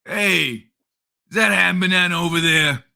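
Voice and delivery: loud crude cartoon neighbour voice; Carl from Aqua Teen. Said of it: "it was a very Carl".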